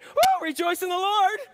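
high, loud sarcastic oh rejoice; mocking chirpy piety; mock cheerful. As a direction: sneer at cheap cheer with a shrill oh. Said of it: "are you saying".

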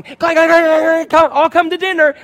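loud high dinner-call clanging; dinner bell clanging. Retold or imitated. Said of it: "I had a pot and pan going".

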